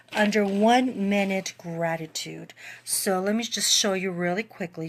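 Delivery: ordinary talk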